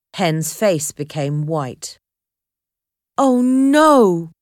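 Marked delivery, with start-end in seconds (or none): 3.2-4.3 loud, high cry of despair; desperate exclamation. A narrator's reading. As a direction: gasp it out in horror. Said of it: "Harvey exclaimed desperately".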